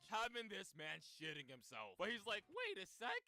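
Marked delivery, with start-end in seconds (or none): 2.5-3.2 high sudden realization. Acted. Higuchi sudden idea.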